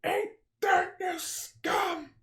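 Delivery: very high cartoon hero voice; Earthworm Jim. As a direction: squeal it like a cartoon worm. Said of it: "Dan Castellaneta's Earthworm Jim".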